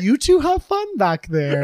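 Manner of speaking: high, slow, drawn-out car voice; talking Tesla. Retold or imitated. Storyteller voices the Tesla to the smooching couple, slow high voice. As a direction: play a car indulgently seeing off two lovers. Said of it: "the Tesla says".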